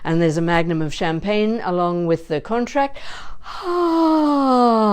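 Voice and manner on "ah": much higher, thrilled sighing ah; delighted sighing ah. Shown as a demonstration. Teacher hearing of a three-film franchise, thrilled ah. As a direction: gasp then release a joyful ah. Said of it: "a joyful welcoming of whatever it is".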